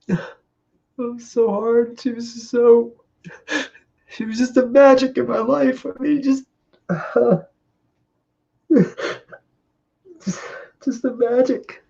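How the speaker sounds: struggling to get the words out through grief; choked up. Real, not acted. Husband remembering his wife, voice catching with grief. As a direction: voice breaking high and slow.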